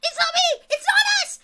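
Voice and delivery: high, loud squealing protest; high goblin squeal of denial; frantic denial. Acted. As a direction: shriek your innocence. Hobgoblin denies blame.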